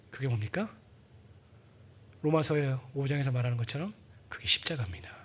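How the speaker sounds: hushed, low whisper; sincere whispered revelation; reverent whisper. Real, not acted. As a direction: whisper the answer with awe. Solemn point of the sermon.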